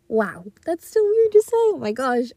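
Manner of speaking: high, loud burst of giddy excitement. Real, not acted. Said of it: "I get, like, a giddy little".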